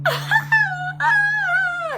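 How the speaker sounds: very high, loud wailing scream; imitated screaming cry. Imitating people screaming and crying through the night. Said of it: "and screaming and crying".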